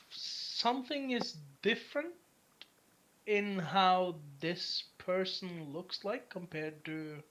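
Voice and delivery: slow, drawn-out, deliberate narration; game master scene-setting. Acted. Game master tells a player what they glimpse, slow suspenseful scene-setting. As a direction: describe it haltingly, stretching key words.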